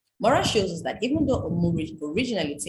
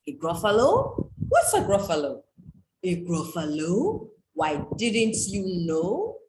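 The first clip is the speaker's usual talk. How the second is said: slow, drawn-out, louder storybook voices; storybook dialogue; 5 dB louder, slower than usual. Acted. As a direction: act out the refrain for children. Acting out the Gruffalo refrain.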